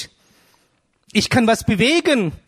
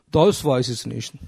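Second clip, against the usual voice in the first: lower, slow, hesitant admission; unsure mumble; 8 semitones lower than usual. Retold or imitated. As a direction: say it like someone who never thought about it. Imagined listener answers unsure.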